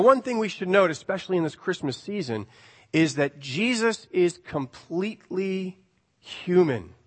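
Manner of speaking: normal talking voice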